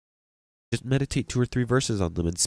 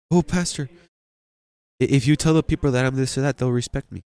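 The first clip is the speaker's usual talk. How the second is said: mock pleading churchgoer, higher at the start; imitating a churchgoer; 4 semitones higher, 4 dB louder than usual. Pastor mimics a member asking him for respect, mock pleading. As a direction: lightly play a member begging the pastor. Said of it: "there are people who".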